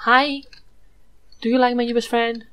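sudden loud cheerful hi; excited hi. Real, not acted. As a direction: call out a happy hello. Greeting new goat companion.

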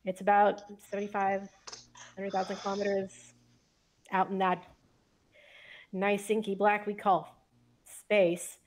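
ordinary talk, nothing marked

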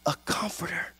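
hushed, breathy whisper; sudden whisper. Acted. Pastor hushing the promise of a comforter, whisper. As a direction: drop to a secret whisper. Dramatic drop in Jesus quote.

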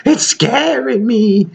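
high, slow, mock-timid girl voice; mock scared coworker. Imitating a coworker who finds the toy scary, mock timid. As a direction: imitate a frightened coworker, drawn out. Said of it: "she's like".

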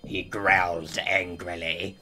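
very low, hushed growling narration. A narrator's reading. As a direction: keep the growl, sinking low and soft. Narration tag kept in growl voice.

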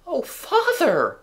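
put-on high, drawn-out girl's exclamation; dismayed exclamation. A narrator's reading. Wendy exclaims at her father's trick, shocked. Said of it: "Wendy exclaimed".

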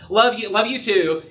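tossed off without thought; an offhand goodbye on the phone, said by rote; rote, automatic. Retold or imitated. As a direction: say it on autopilot, out the door. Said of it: "And it's almost rote".

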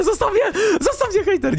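high laughing mock scream; laughing panic. Fleeing the hejter's shots, a high laughing mock plea. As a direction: shriek it while laughing.

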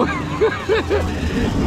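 high airy laugh mid-ride; breathless ride laugh. Real, not acted. (râs gâfâit, foarte sus)

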